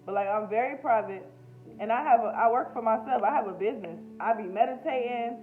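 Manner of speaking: ordinary talk, nothing marked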